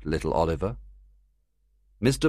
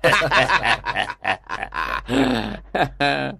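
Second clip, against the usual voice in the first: put-on gang laughing at the boy; jeering cackle; mocking laughter; 6 semitones higher than usual. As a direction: cruel group laugh. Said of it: "Everyone laughed at Oliver".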